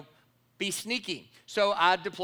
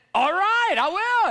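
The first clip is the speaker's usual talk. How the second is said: high, loud, cheery yes; eager acceptance; 12 semitones higher, 10 dB louder, slower than usual. Retold or imitated. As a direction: jump at the offer gladly.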